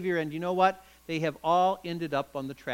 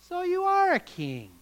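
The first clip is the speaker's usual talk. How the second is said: much higher, sly with a hint of sarcasm; sly, sarcastic; 11 semitones higher than usual. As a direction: needle him with mock politeness. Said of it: "asked in a very sly voice with a hint of sarcasm".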